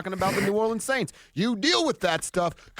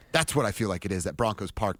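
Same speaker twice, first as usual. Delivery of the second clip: ordinary talk, nothing marked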